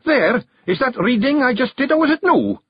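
high, rising challenge; demanding. Acted. Rob demands the clan admit he read it, challenging. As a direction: dare them to say otherwise. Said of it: "and demanded".

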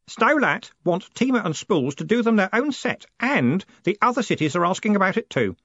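put-on high, excited boy's voice; keen, breaking the amazing news; eager Stanley. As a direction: bubble over with news in a put-on boyish voice.

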